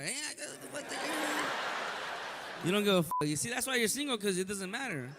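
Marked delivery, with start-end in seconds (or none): 0.0-2.7 mock paisa voice. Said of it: "Little fucking paisa".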